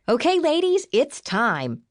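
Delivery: high-pitched, ultra-excited announcement; ultra-excited host voice, starting way up high; game show host. A narrator's reading. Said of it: "announced the occasion in her game show host voice".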